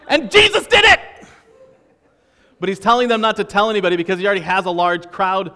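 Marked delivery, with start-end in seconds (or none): 0.1-1.0 much higher, louder triumphant cry; joyful shout. Acted. Healed blind man proclaiming Jesus to the crowd, ecstatic shout. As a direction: crow it out to the whole street.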